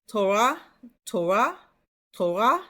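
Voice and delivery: "Torah" with R from the back of the throat; throaty R. Shown as a demonstration. Teacher modelling for learners, "Torah" with back-of-throat R. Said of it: "R is a little bit from the back of your throat".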